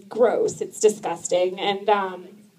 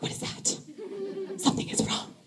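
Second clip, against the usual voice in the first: whispered worry; hushed alarmed whisper; 9 semitones higher, 13 dB quieter than usual. Retold or imitated. Alarmed at night.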